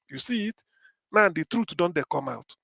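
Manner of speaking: normal talking voice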